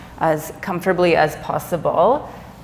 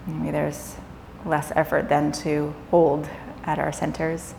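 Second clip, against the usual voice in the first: calm yoga nidra guidance with long pauses; guided meditation; 5 dB quieter than usual. Acted. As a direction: ease them gently into rest, leaving space between phrases.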